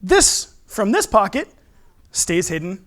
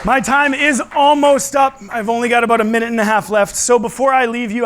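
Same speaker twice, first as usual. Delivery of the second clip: put-on kids party magician; 4 semitones higher, 6 dB louder than usual.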